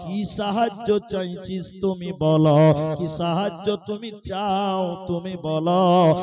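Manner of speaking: loud, rhythmic sermon delivery; impassioned preaching. Acted. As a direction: preach it at full force.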